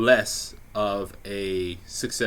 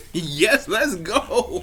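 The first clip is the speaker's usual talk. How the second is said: laughing, much higher and louder yes; eager yes; 11 semitones higher, 5 dB louder, much slower than usual. Pretend. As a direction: blurt it out, over-eager and laughing. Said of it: "I didn't say it like that".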